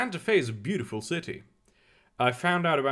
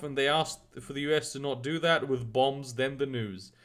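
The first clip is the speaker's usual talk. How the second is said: ordinary delivery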